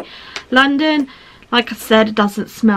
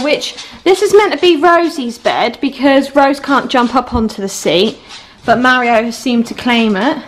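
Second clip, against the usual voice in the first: ordinary delivery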